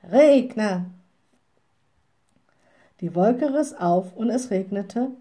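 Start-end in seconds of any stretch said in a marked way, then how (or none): 0.0-0.9 loud, commanding call; one forceful cry of command. A narrator's reading. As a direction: call the spell up to the cloud. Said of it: "winkte sie näher und rief".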